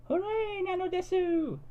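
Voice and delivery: high squeaky cheer; high squeaky cheer over free sandwiches; cheering anime girl. A narrator's reading. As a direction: squeal it like a delighted cartoon girl. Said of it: "Cud cried".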